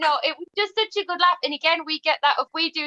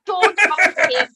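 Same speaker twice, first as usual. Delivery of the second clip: shouting; 4 semitones higher, 5 dB louder, much slower than usual. Retold or imitated. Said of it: "I was like".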